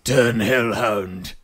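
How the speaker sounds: slow, gruff Scottish growl; drawn-out gruff Scottish accent. Shown as a demonstration. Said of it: "will you say it in a gruff Scottish accent".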